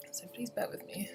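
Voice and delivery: soft unvoiced whispered aside. Real, not acted. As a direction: whisper an apology while fiddling. Fixing phone, quiet aside.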